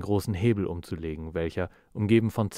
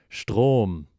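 louder, sudden realization; shocked exclamation; 2 semitones higher, 6 dB louder than usual. Acted. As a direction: blurt "Strom!" as it dawns on him.

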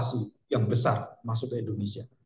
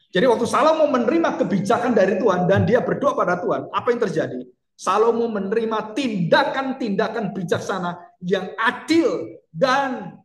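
higher fervent preaching; 7 semitones higher, 3 dB louder than usual. Acted. Preaching at full force.

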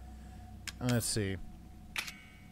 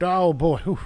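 startled; 6 semitones higher, 9 dB louder than usual. Real, not acted. Phone fell, startled.

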